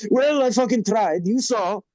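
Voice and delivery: high-pitched imitation of an exasperated owner; restaurant owner. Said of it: "he goes".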